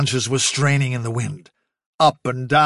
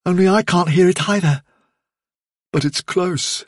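higher-pitched rabbit listening hard; Fiver, intent and distant; 4 semitones higher than usual. A narrator's reading. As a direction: strain to catch something far off, pitched up.

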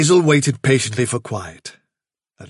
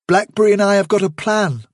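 raised, steady leader voice; young leader addressing the warren; Hazel's calm resolve; 8 semitones higher than usual. Acted.

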